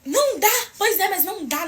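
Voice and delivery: high-pitched mock shouting; retold yelling. Clerk cutting off the customer, mock yelling. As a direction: imitate an insistent, yelling clerk. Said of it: "Gente, gritando".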